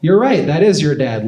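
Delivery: high, loud sing-song mom voice; mom talking to baby. Retold or imitated. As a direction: coo to the baby then turn on him.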